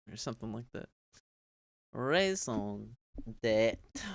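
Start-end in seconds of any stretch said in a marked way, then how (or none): none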